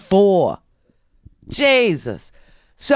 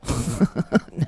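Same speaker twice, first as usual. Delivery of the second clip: soft, low laugh; real chuckle; 8 semitones lower, 5 dB quieter than usual. Real, not acted. Host amused by own colonoscopy joke, soft chuckle.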